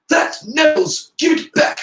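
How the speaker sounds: high-pitched loud demand; shout. A narrator's reading. Ernie demanding Neville's ball back, shouting. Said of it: "shouted Ernie".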